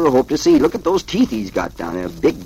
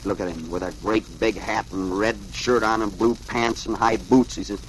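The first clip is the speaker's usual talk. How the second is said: ordinary delivery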